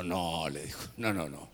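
low, quiet denial; retold grave refusal. Moses correcting Joshua on the singing, grave and low. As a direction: answer low and subdued.

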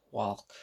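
"Walk" with the L sounded, softly; pronounced L. Retold or imitated. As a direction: quietly mimic "Walk" with an audible L. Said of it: "He actually pronounces the L".

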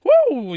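high loud whoop. Real, not acted. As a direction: let out a throat-clearing whoop.

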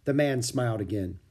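ordinary delivery, nothing marked

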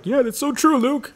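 high, bright, smiling voice; happy listener voice. Retold or imitated. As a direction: sound like a delighted fan. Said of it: "you're probably smiling right now".

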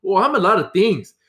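put-on high, loud, indignant Brazilian-accented protest; angry Brazilian accent. Brazilian coworker pointing a knife, indignant accented protest. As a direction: imitate an offended Brazilian coworker. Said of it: "he was like".

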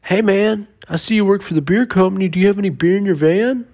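high, fast hustling voice; mock street hustler asking for beer; imitating a crackhead. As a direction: pester him quickly. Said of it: "this crackhead goes".

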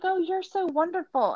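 high-pitched mock adoring coo; fake gushing. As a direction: gush with pretended sincerity. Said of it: "you're pretending it's genuine".

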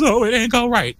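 high mocking whine; high-pitched mock complaint. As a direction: parody a whiny complainer.

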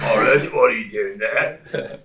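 a hint of a Muppet-like British uncle; lightly voiced puppet-style British uncle, drawn out. Comic doing his Uncle Tim as a British Muppet. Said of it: "He's like a f-, fucking, like, British Muppet".

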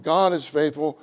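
ordinary talk

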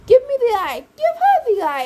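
frantic yell at the sisters. A narrator's reading. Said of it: "Annabeth yelled".

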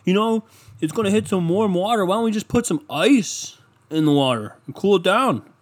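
high, goofy voice of a clueless guy on a rooftop; dumb guy voice. Retold or imitated. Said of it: "He's like".

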